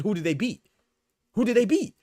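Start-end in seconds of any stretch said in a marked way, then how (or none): 1.3-1.9 same question again, higher and louder; louder, higher repeat. Retold or imitated. Challenging fans of Boots, louder higher repeat. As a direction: push it louder in disbelief. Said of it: "because I said".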